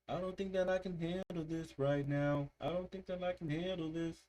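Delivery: high, pleading imitation of the sung line; imitating a cry for help. Fan reacting to a song, echoing its plea. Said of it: "this sounds like a cry for help".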